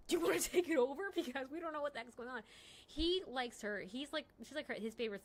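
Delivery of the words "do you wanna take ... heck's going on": laughing offer. Retold or imitated. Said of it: "she like".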